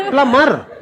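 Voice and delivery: laughing repeat. Real, not acted. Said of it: "plumber होता है".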